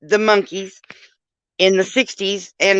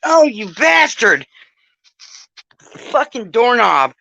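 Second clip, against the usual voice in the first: drawn-out angry curse; 4 semitones higher, 6 dB louder, slower than usual. Real, not acted. Cursing the doorknob.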